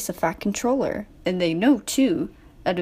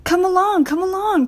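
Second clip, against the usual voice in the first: high, louder puffing call; hurried high engine chant; 9 semitones higher, 4 dB louder than usual. A narrator's reading. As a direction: urge the coaches on as you puff. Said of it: "puffed Thomas".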